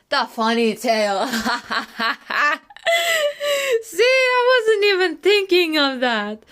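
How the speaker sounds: high, loud speech bursting with laughter; cracking up. Real, not acted. Vlogger surprised by their own funny tail pun, cracking up. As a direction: squeal through uncontrollable laughter.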